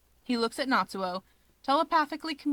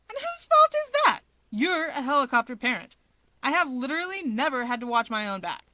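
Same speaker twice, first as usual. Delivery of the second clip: much higher and louder, indignant and fast; shameless demand; 5 semitones higher, 5 dB louder than usual. Acted. Natsuo demanding of his brother Dabi, loud and shameless. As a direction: fire it back at him, brazenly. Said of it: "Shamelessly, he demands".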